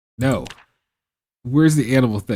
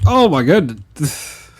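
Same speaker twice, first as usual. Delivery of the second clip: exasperated; 6 semitones higher, 6 dB louder, slower than usual. Real, not acted. Exasperated at game.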